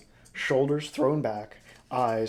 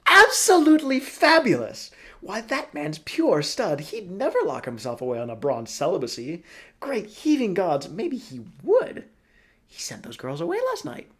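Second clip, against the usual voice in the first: Broly character voice; 7 semitones higher, 10 dB louder than usual. Acted. Broly gushes to Helva about Niall, high and loud. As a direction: gush over the man as a theatrical old schemer.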